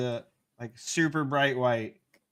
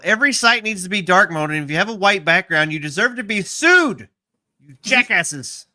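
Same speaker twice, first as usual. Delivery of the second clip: mock rant; 4 semitones higher, 8 dB louder than usual.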